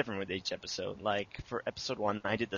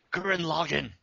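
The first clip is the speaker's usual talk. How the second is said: high, excited title call; excited exclamation; 7 semitones higher than usual. Real, not acted. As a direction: belt out the favorite show's title. Calls out a favorite mecha title.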